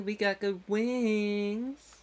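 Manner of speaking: very slow, stretched words rising at the end; drawn-out silly voice. Acted. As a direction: stretch out "wings" playfully. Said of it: "what I can do with my voice".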